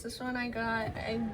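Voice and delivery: ordinary delivery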